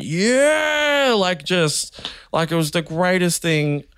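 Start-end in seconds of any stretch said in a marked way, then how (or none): 0.0-1.1 crowd cheer. Retold or imitated. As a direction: cheer it like a whole pub going wild. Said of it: "everyone in that pub just went".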